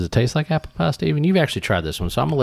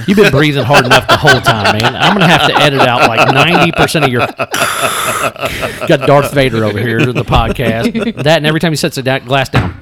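laughing tease; 3 semitones higher, 7 dB louder than usual. Real, not acted. Teasing friend's loud breathing.

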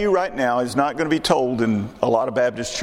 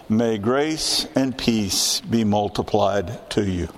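lower, measured Bible reading voice; scripture reading; 3 semitones lower than usual. Acted. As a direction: read the verses out solemnly to the congregation. Reads First Peter aloud.

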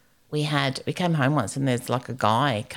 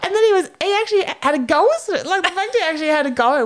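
excited fast telling; 5 dB louder, faster than usual. Real, not acted. Excited retelling, fast high.

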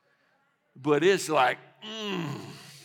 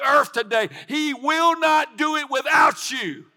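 sermon rising into loud, high cries; full-force preaching; 9 semitones higher, 11 dB louder than usual. Real, not acted. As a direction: build phrase by phrase to a preacher's shout. Preaching builds to a peak.

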